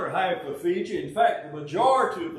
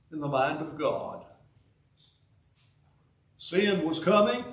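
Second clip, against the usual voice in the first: ordinary delivery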